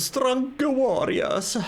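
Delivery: high, drawn-out mock Italian accent; bad Italian accent. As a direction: mimic a game character in a hammed-up Italian accent. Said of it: "horrible Italian accent".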